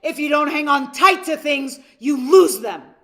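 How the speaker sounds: loud, scolding father shouting at his son; angry dad. Retold or imitated. Dad scolding his son for losing the balloon, angry and loud. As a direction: bark it at the kid like a furious father. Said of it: "in an angry tone".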